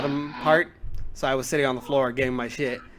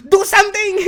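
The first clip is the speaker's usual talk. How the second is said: high, loud, helpless squeal of a flustered teacher; exaggerated high panic cry; panicked teacher; 8 dB louder than usual. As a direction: beg the student to step in. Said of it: "Is that exactly how he sounded?".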